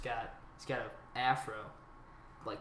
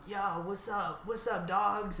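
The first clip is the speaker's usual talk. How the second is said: higher-pitched mock wankster greeting; wannabe gangster; 7 semitones higher, slower than usual. Sketchy wannabe greeting the boys walking in, mock wankster voice. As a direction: imitate a sketchy wannabe hailing his crew. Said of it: "wankster-looking dude".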